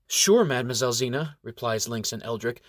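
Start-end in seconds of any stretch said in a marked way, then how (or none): none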